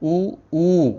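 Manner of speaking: उ clipped short, then ऊ held long. Shown as a demonstration. Teacher contrasting vowel lengths for the class, short then long. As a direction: contrast उ short against ऊ drawn out. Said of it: "उच्चारण की दृष्टि से".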